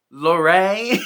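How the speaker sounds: mock the odd lift on Lorraine; mimicked weird emphasis. Host mocking how they read the name, weird emphasis on Lorraine. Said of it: "put such weird emphasis on that".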